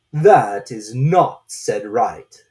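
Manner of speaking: very slow, loud, strange drawn-out voice; slow caterpillar drawl. A narrator's reading. Caterpillar lazily questioning Alice, very slow and loud.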